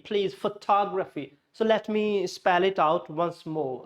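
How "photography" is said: photography as fuh-TOG-ruh-fee; photography with reduced first and third vowels; stressed TOG in photography. Shown as a demonstration. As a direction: make "tog" clear in photography. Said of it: "tog should be clear".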